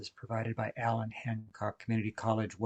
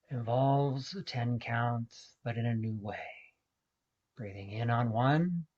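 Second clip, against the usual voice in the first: guided meditation. Acted. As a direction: guide listeners gently through each breath, unhurried. Leads a guided breathing meditation.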